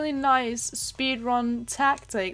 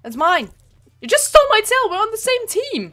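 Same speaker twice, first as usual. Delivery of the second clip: loud, high, playful protest; mock outrage; 7 semitones higher, 9 dB louder than usual. Gamer yelling at a teammate who stole her tail, loud mock outrage. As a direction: yell at the thief as if truly wronged.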